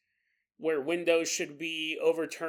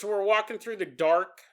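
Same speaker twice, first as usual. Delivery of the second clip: usual speaking voice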